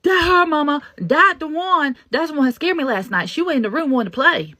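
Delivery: put-on high, loud child's voice; excited little boy. Son recognizing the ghost girl, high excited child voice. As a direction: play the boy pointing out the girl. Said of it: "It was her".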